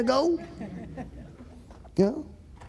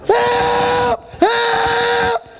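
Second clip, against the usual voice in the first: long, high, loud cries for help; high-pitched mock-panic scream; 13 semitones higher, 4 dB louder than usual. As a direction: scream it out as if on a runaway ride. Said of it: "I'm still screaming".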